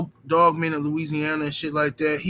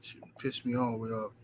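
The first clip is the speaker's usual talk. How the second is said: upset low muttering; 6 semitones lower, 15 dB quieter than usual. Real, not acted. Owner grumbling about his lost dog, low and soft. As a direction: lower and much softer. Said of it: "I'm upset".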